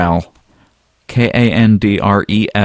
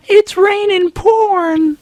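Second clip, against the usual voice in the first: high, slow ecstatic cry to the sky; ecstatic crowd cry; much slower than usual. Retold or imitated. Furries reaching to the sky, crying out in slow high rapture. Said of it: "reaching up to the sky going".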